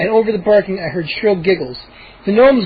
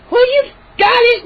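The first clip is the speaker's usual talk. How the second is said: very high sarcastic outburst; angry exclamation; 4 dB louder than usual. Acted. Mindy retorting to the gnome. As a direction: snap back sarcastically. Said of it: "Mindy exclaimed".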